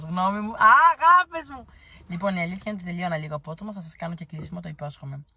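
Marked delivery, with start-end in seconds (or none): 0.5-1.6 much higher and louder, excited; gushing greeting. Real, not acted. Greeting her loving followers with an excited squeal. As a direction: squeal it to your fans.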